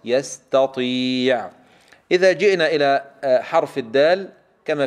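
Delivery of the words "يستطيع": يستطيع very slow, light ت then heavy long طي; drawn-out recitation of يستطيع; separated taa and Taa. Shown as a demonstration. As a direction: stretch يستطيع, keeping ت clear of ط. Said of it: "التاء من الطاء".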